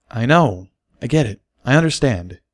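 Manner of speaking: eager to reassure, a little jittery; nervous Bucky. A narrator's reading. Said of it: "nervously dancing on his hooves".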